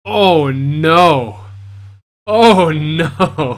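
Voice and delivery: shouted oh no; loud shout. Real, not acted. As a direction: cry it out loud. Shocked reaction to the game.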